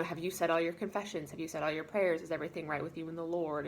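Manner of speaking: normal talking voice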